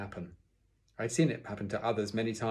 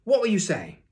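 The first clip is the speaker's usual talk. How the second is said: high, loud, demanding question; drill-sergeant interrogation; shouting; 12 semitones higher, 10 dB louder than usual. A narrator's reading. Teacher demanding an answer from pupil, shouting. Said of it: "He was shouting at me".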